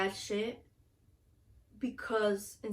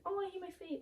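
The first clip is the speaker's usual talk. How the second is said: high, soft mock whine; whiny complaint; 9 semitones higher, 8 dB quieter than usual. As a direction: sigh it like a self-conscious girl.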